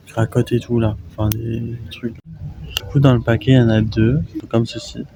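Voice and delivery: usual speaking voice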